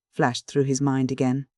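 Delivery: usual speaking voice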